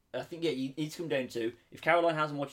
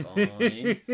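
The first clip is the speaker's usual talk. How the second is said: laughing; 10 semitones higher, 8 dB louder than usual. Real, not acted. Mock acceptance of ranking.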